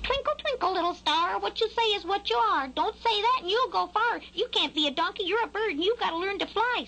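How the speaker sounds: very high singsong squawk; scolding crow. A narrator's reading. Crow telling Skylark he's a bird, high scolding. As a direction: rhyme and scold like a bossy crow. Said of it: "scolded the crow".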